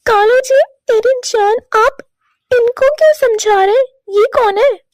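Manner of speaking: cling to him sweetly; clingy ex. Acted.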